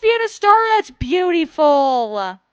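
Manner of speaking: high, loud squeal of delight; excited gushing. Real, not acted. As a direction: gush loudly over a gift, drawing out the last words. Gushing over fan's art.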